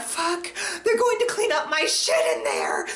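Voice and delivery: sudden horrified realisation, voice shooting up; overhearing the intercom, a panicked high-pitched cry; panicked high yelp. Retold or imitated.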